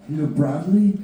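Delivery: mild imitation of a woman caller; lady on the phone. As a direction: sweetly ask for Bradley like a caller. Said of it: "this lady's going".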